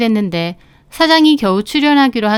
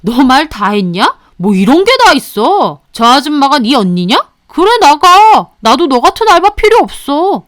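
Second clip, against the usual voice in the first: fuming boss; 3 semitones higher, 4 dB louder than usual. Acted. Boss firing the part-timer, furious shout. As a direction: boss throws the worker out in a rage. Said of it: "사장도 화가 나 씩씩거리더라고요".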